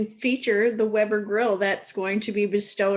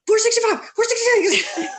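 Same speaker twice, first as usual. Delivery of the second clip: high, loud, rushed counting through laughter; frantic counting; 11 semitones higher, 5 dB louder than usual. Retold or imitated. Houseguest counting fast while desperate for the bathroom, laughing frantic count. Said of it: "she's like".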